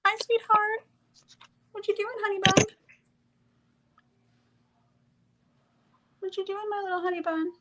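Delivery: very high, sing-song cooing at a pet. Real, not acted. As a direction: coo up high like talking to a puppy. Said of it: "talk like that to me".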